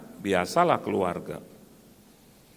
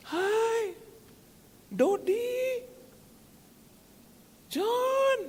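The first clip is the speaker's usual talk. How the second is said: very high, drawn-out greeting call; excited calling out; much slower than usual. Acted. Driver stops to hail someone on the road, high excited call. Acted driver hailing acquaintances.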